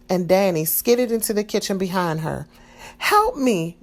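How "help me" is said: cry for help. A narrator's reading. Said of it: "Maria cried".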